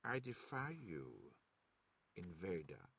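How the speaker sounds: put-on low, slow defiance of a frail old man; lightly voiced elderly man, thick antique accent. A narrator's reading. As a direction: defy him quietly and slowly, old and bitter. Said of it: "his accent thick and antique".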